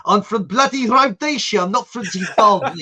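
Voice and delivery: put-on loud, put out Rhodesian accent. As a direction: imitate an indignant Rhodesian, loudly. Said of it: "there's something about, about his accent".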